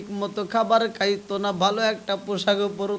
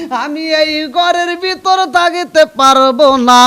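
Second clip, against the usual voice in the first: high, loud chanted protest; loud refusal; 6 semitones higher, 11 dB louder than usual. Acted. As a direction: belt out the refusal, holding the last word. Wife's refusal acted in sermon.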